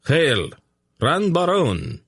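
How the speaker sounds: high ceremonial hail, mildly thundered; thundered salute. A narrator's reading. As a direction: hail the emperor like massed legions. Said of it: "the legions thundered".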